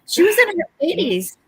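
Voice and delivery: acting shocked over her age; dismayed exclamation. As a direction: blurt it out in mock alarm. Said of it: "I said".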